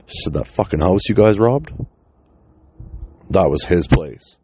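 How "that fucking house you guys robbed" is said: higher, louder outraged question; an angry mom confronting her son; mom yelling. Retold or imitated.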